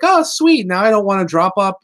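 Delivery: high, loud burst of glee, easing off; acted-out delighted relief; mock relief. Retold relief of the young six.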